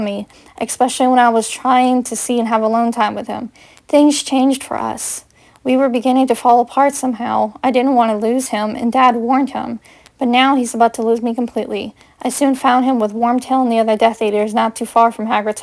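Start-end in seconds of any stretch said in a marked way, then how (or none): none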